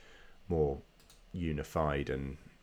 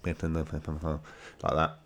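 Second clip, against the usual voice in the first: stuffy nose from a cold; talking through a blocked nose; nasal voice. Real, not acted. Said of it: "so I sound a bit nasally".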